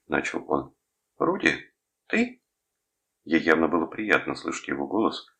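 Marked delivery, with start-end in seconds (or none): 1.2-2.4 put-on very high young woman's voice; Julie's voice. A narrator's reading. Girlfriend recognising her caller on the phone, high girlish voice. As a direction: pitch it up high as a girl answering. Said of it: "он услыхал голос Джулии".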